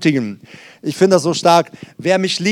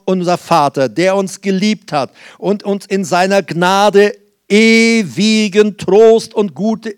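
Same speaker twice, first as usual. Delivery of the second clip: raised, slow, emphatic benediction; solemn blessing; 4 semitones higher, slower than usual. Acted. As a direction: bless the listeners fervently. (feierlicher Segen, gehoben und langsam)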